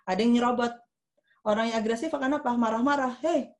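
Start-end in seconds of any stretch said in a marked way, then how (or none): 3.2-3.5 high, louder mock scolding; aggressive, rude. Queue victim snapping at a line-cutter, rude and angry. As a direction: act out an angry telling-off, pitched up. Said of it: "kasar ngomongnya".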